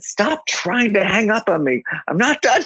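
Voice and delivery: high-pitched, drawn-out retold protest; mock exasperation. Retold exasperated caller stops hang-up.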